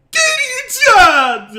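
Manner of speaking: high, very slow, drawn-out shout; loud, stretched Majima-style yell; Majima's call. Retold or imitated. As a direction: stretch the name out in a manic gangster yell. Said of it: "Majima's is out there".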